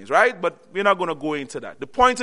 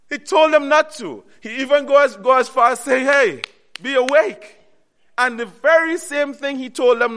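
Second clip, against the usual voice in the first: louder, higher preaching push; preaching at full force; 7 semitones higher, 6 dB louder than usual. Acted. Preacher to congregation, raised and pressing. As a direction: drive the point home to the congregation.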